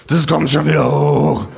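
put-on drunken bellow. Narrator telling the two men to leave the kangaroo, drunken bellow. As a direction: holler it across the bar. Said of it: "gröhl ich".

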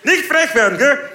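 sehr hoch und laut, drohend; empörter Polizist. Retold or imitated. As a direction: droh mit erhobenem Finger. Polizist erneut nachgemacht. (bayerischer Polizist empört, sehr hoch und laut)

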